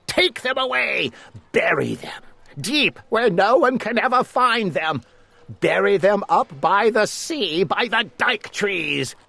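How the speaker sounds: high, pressing villain orders; urgent commands. A narrator's reading. Lord Droon ordering Eric to bury the stilts, urgent and commanding. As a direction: rush him with orders. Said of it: "commanded Lord Droon".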